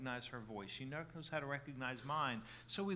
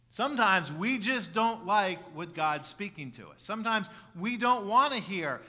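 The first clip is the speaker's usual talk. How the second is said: loud, higher preacher's voice; raised sermon voice, punching key words; forceful preaching; 6 semitones higher, 12 dB louder than usual. Real, not acted. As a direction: drive it home to the congregation.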